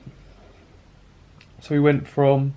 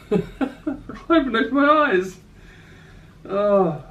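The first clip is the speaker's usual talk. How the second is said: sleepy laugh; 8 semitones higher, slower than usual. Real, not acted. Just woke up, laughing.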